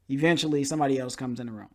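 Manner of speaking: normal talking voice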